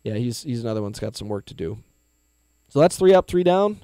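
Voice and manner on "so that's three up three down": booming inning-end call; announcer call. Acted.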